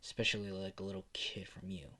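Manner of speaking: hushed, annoyed rebuff; agitated. Acted. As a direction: whisper it, mildly agitated. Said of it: "get a little bit agitated and says".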